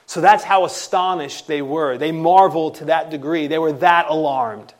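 loud, emphatic sermon delivery; full-force preaching. Acted. Preacher stressing to the congregation, loud and emphatic. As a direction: drive home how awestruck they were.

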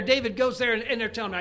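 ordinary talk, nothing marked

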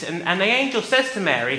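ordinary talk

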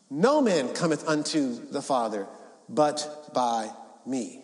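slow, pause-laden declaration; measured solemn scripture reading; solemn proclamation. Acted. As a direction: let each phrase land with a long pause. Jesus' words, dramatic pauses.

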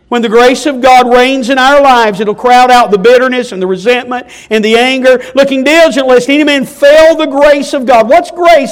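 loud, high, impassioned preaching; preaching at full force. Acted. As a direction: build the plea to a shouting peak. Preaching climax.

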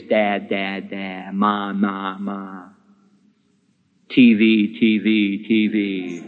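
a hint of a toddler calling out; baby babble. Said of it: "when a little baby says".

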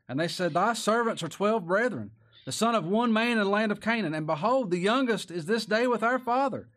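loud, high preached scripture; full-voiced pulpit reading; preaching at full force. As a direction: proclaim it to the back pews.

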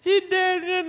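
hammed-up wail, pitched very high; mock wailing. Preacher acts out mourners crying over the dead girl, mock wailing. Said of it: "there's crying and weeping and a big thing going".

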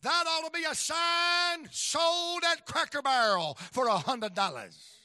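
preacher crowing his joke to the crowd, loud and slow; hammed-up proclamation. Real, not acted. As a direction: sell the punchline big.

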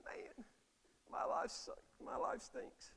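breathy mock self-pity, high and unvoiced; mock whining complaint. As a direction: whine it like a sulking sinner. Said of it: "you say".

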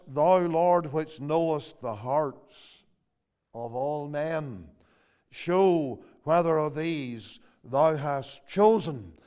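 slow, hushed reading of the prayer; solemn scripture reading. Acted. Preacher reading verse twenty-four to the congregation, slow and reverent. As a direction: read it slowly and gravely, like a prayer.

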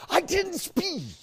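high, slow, drawn-out whine; whiny protest. Retold or imitated. Insisting to the clerk he never sped, high and whiny. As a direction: stretch it out like a sulky kid. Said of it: "I said".

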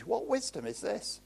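usual speaking voice

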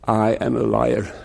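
low, humble confession; sinner confessing. Retold or imitated. Preacher voicing the sinner repeating after the Lord, low and humble. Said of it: "You say".

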